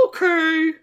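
tiny high Tails squeak; Tails, meek. Acted. Reader voices Tails giving in to Sonic, high and meek.

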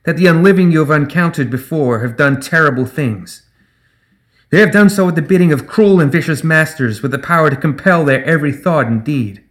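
clear, projected public speech; high and clear. A narrator's reading. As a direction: address a whole crowd with firm conviction. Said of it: "Her voice rang out across the square, high and clear".

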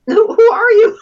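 high, loud startled who; surprised. Retold or imitated. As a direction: blurt it out in surprise. Retold startled question.

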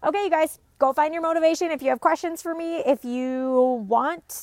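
rally the viewers cheerfully; upbeat sign-off. Real, not acted.

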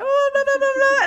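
high, loud gushing babble imitating old women; little grandmas. Grandmas fussing over her in another language, high mock chatter. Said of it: "like, little grandmas".

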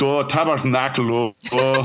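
put-on light joking Quebec accent on tabarnak; a playful hint of Quebec swearing. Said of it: "throw some Quebec accent on there".

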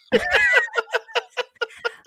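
high, loud laugh bursting out; big burst of laughter. Real, not acted. Laughing hard after finishing the poutine story. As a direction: crack up at your own punchline.

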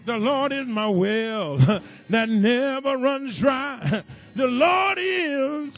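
whooping sermon climax. Acted. Preacher chanting to the church over the organ, loud and slow. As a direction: ride the music and whoop it home.

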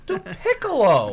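high-pitched, incredulous repeat; mock outrage. Real, not acted. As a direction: wail it in comic disbelief. Mock outrage over film's Piccolo.

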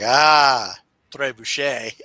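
loud, proud "Ah, très bouché" with French vowels; mock proud French. Dad to the French teacher, proudly botching French. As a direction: show off a wrong French phrase boldly. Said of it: "I'm like".

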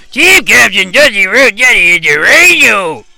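high, loud excited cry. Acted. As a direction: erupt with glee. Excited recognition on screen.

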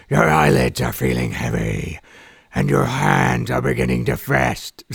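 hoarse superhero sleep-app voice. Acted. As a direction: improvise hypnosis in a raspy hero voice. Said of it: "I can't do this, the impression".

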